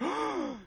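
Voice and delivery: sharp high gasp, almost no voice; shocked gasp. Retold or imitated. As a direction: gasp as if hearing terrible news. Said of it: "It's like".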